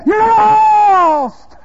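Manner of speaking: very high, loud accusing cry; sharp, stern shout. Acted. Preacher voicing the Holy Ghost's verdict to a sinner, stern shout. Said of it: "sharply and sternly".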